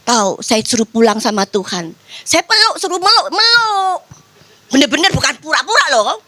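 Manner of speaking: ordinary talk, nothing marked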